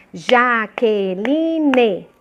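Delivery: "Jaqueline" dito devagar, sílaba por sílaba; sílabas separadas. Shown as a demonstration. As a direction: split "Jaqueline" into four slow, clapped-out syllables. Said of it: "você pode bater palma, assim, ó". ("Jaqueline" pausado em sílabas)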